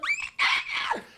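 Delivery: very high dinosaur shriek; piercing playful creature cry; raptor screech. Retold or imitated. Dad being a raptor.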